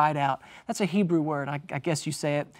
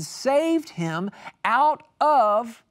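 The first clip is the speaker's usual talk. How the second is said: OUT OF slow, raised and loud; stress on OUT OF; 6 semitones higher, 5 dB louder, much slower than usual. Shown as a demonstration. Preacher pointing out words to note, "out of" stressed. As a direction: lean hard and long on "out of". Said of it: "I told you to make note of those words".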